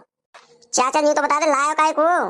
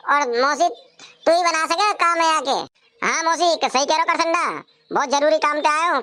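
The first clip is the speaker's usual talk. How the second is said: ordinary talk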